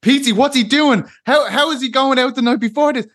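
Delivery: high, panicked voice of worried friends; mock panicked fan on the phone; worried callers. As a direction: squeal it like a frantic caller back home. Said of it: "everyone's ringing me".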